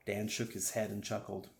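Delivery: plain reading voice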